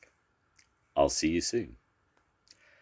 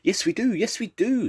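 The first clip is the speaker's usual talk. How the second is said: very high, louder bird squawks; squawking birds; 4 dB louder than usual. A narrator's reading. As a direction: squawk it like excited rainbow birds. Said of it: "squawked the b".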